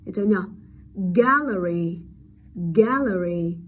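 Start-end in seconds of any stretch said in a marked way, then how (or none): 1.2-3.6 Gallery with a clear æ in the first syllable; open e vowel. Shown as a demonstration. Teacher models the answer word, gallery with open æ. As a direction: lean on the æ of "gallery". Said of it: "âm e bẹt đúng không".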